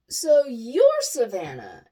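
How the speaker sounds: slow, loud amazed question. Retold or imitated. Meeting the named coworker.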